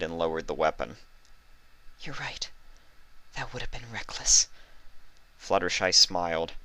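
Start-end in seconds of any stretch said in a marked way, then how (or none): 2.0-4.5 high, soft, timid mare; a shy pony giving in, gently; Fluttershy's voice. A narrator's reading.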